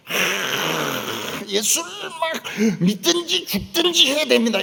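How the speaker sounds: 훨씬 높고 울부짖듯 외치는 부흥 설교; 죽기 살기로 몰아붙이듯 외쳐라; 불같은 설교 흉내. Retold or imitated. 경상도식 설교 흉내. (열띤 부흥사 설교 흉내)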